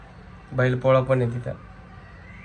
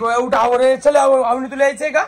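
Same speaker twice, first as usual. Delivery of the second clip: higher, louder calls to get up and go; loud urging; 9 semitones higher, 7 dB louder than usual. Real, not acted. Husband calling wife and child to hurry, loud urging. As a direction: push them out the door, loudly.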